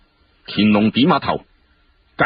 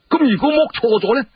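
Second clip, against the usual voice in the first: sharp doubting question; 14 semitones higher than usual. Acted. Challenging question in court debate.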